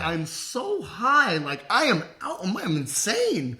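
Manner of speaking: high-pitched panic. Retold or imitated. Handcuffed on the curb, squeaky stoned panic. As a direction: stretch it out, high and dazed.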